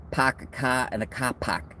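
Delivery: put-on Boston accent. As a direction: do a quick mock Boston accent with no R's. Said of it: "as they would say in Boston".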